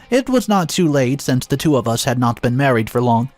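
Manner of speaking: plain reading voice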